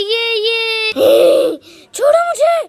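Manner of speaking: high shrieking cry to be freed; trapped shriek. Acted. Witch caught in the net, shrill shriek. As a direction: screech from inside the net.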